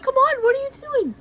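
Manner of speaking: high, loud, fast mock panic; retold frantic urging. Urging friend shopping during tornado, retold frantic yell. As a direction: hurry her along, high and rushed.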